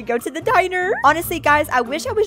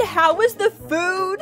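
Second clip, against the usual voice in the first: high, drawn-out neighbor voice; creepy neighbor; 5 semitones higher, slower than usual. Acted. Creepy neighbor checking on the food, slow high voice. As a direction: stretch the question out in a put-on high voice. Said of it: "He said".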